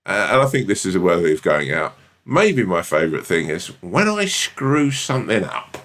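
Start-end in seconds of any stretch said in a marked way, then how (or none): 3.8-5.8 slow Partridge impression. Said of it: "the most Partridge thing you've ever said".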